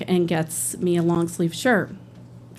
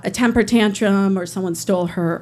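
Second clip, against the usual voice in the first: normal talking voice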